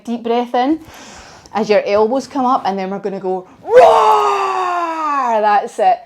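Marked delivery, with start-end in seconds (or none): 3.6-5.4 big, slow, shouted dragon roar; a long, loud demo roar for the children. Shown as a demonstration. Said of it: "let me show you how to do a dragon roar".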